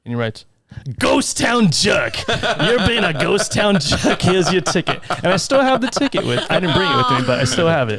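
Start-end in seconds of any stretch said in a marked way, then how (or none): 1.0-2.1 slow, high, shouted character voice; mimicking John. Scare actor writing out a ticket, shouted character impression. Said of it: "I'm trying to mimic his voice". 2.3-5.0 high, drawn-out mock scolding; mimicking John. Scare actor handing over a joke ticket, mimicked character voice. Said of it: "I'm trying to mimic his voice".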